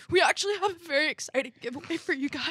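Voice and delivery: high, loud mock-tearful announcement; hammed-up tearful excitement; fake cry. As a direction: put on a weepy voice for the big news. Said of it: "Do a little fake cry".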